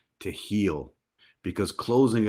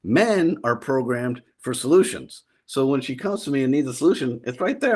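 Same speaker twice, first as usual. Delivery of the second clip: ordinary talk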